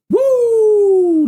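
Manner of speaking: imitating a siren with a very high, loud woo; high police siren woo. Teller imitates the police siren, a loud high woo. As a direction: mimic the siren as the cops show up.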